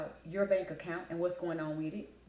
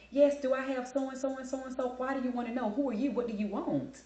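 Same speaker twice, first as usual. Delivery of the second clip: higher-pitched, suspicious questioning; confrontational phone answer; 6 semitones higher, 3 dB louder than usual. Acted. Screening a nosy caller on her phone, confrontational. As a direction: challenge the caller without letting up. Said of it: "That's how we do it on this phone".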